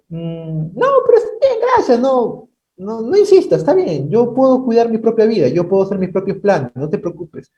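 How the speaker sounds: high, put-on insistent voice; mocking stubborn refusal. Preacher mimicking a proud believer rejecting God's care, high and whiny. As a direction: refuse help in a squeaky mock tone.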